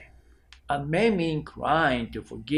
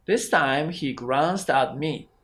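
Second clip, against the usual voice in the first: ordinary delivery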